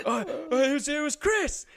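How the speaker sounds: high, loud, stammered mock panic; panicked blame-shifting. Kid blaming his friend to angry dad, panicked stammer. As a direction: stammer it out like you're caught.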